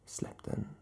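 soft commanding whisper. A narrator's reading. Woman orders the man to drop the knife, whispered command. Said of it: "befallde hon".

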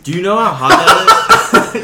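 loud, slow, laughing through the burn; half-laughing, half-groaning; laughing in pain. Real, not acted. Ghost pepper burning his mouth.

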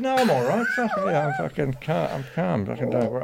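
high, strained insistence on being calm; acted frantic reassurance, pitch settling as it goes; nearly crying. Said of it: "I'm nearly fucking crying".

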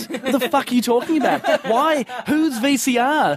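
high-pitched incredulous inner rant; hammed-up exasperated bafflement; baffled outburst. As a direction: question it all in rising disbelief. Acting his baffled inner reaction.

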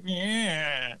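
long satirical impersonator's drawl; Max Gillies doing Bob Hawke. Acted. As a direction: stretch one word into a caricature. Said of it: "Max Gillies' impression is sort of turning and tugging the ear and going".

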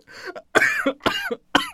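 high strained coughing; choking cough. Real, not acted. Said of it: "I'm choking".

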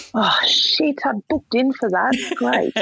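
dismayed aside. Retold or imitated. Dismayed aside about gun licence.

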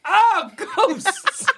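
high, laughing cry of alarm; mock alarm. Player hears the farm has ghosts, high laughing alarm. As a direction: yelp at the word ghosts, half laughing.

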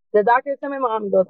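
usual speaking voice

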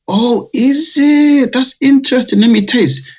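high-pitched, drawn-out mock customer surprise; intrigued customer. Customer hears it's a cognac cocktail, intrigued high voice. Retold customers reacting to samples.

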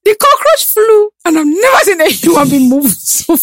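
high, slow, stretched out cockroach; excited retelling. Real, not acted. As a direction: tell it with wide-eyed amazement. Retelling a man fleeing a cockroach.

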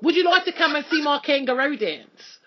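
hammed-up Australian voice; Australian accent. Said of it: "Don't you like my Australian accent?".

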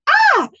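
a sudden very high, loud yelp. Shown as a demonstration. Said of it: "If I raise my voice, it's gonna be like".

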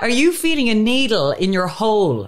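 high-pitched mock disbelief; mock incredulous questioning. Mock questioning of the medical staff.

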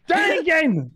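very high squealed demand; hammed-up squeal. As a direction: squeal it out in mock anguish.

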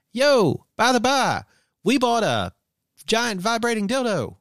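high, loud yell from the street; excited shout. Pretend. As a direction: call it out proudly. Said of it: "drive by our house and say".

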